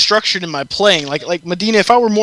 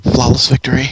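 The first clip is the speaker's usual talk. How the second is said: much lower Mortal Kombat announcer call; deep announcer voice; 6 semitones lower than usual. Pretend. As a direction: boom it like a fight game announcer.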